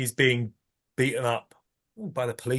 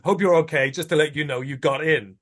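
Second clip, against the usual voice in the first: normal talking voice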